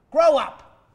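loud and contemptuous; shouted scolding. Acted. Therapist yells at the crying patient, loud and scornful. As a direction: bark it at him like a scolding parent.

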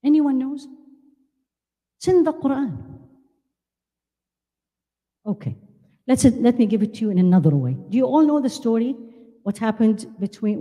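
ordinary talk, nothing marked

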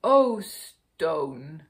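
loud, falling cry of dismay; storybook bear's outburst of disappointment; lament. A narrator's reading. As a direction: wail it at the stone. Bear laments the broken stone.

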